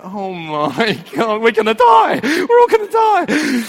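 high, loud hammed-up wail of terror; mock panic. Passengers watching a fight on the train, mock panic. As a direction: build from a drawn-out gasp to comic shrieking panic.

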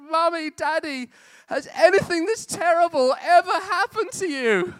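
put-on high, loud little girl crying out. Dad retelling daughter's lost-tooth wail, high childish cry. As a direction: play his five-year-old daughter wailing in distress. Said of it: "she shouted out".

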